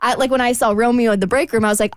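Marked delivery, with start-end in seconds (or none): none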